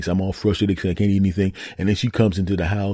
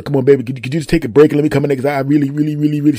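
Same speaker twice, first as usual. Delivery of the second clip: higher, urgent begging; urgent pleading; 7 semitones higher, 3 dB louder, faster than usual. Acted. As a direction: plead through the door to be let in. Pleads for the bathroom.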